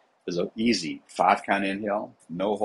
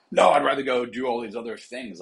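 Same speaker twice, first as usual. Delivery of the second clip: higher, louder dismissive client; stubborn client; 6 semitones higher, 5 dB louder than usual. Retold or imitated. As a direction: brush off the advice like a stubborn athlete. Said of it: "I had clients who were like".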